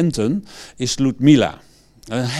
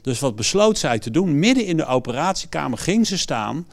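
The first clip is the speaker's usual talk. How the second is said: ordinary talk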